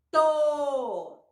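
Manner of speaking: To with a long held o; blended syllable demo. Shown as a demonstration. Teacher joining T and O, sounded out. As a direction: launch the T and sustain the o in To. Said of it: "fazer agora com elas juntas".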